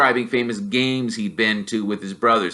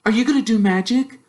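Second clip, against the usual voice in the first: higher, prim know-it-all schoolgirl; a lightly raised, eager schoolgirl voice; bossy girl; 10 semitones higher than usual. Acted. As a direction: challenge him like a clever girl. Hermione voiced by narrator.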